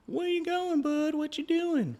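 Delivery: very high soft coo; high pet voice. Real, not acted. Owner asking his wandering dog, very high coo. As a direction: coo after the dog softly.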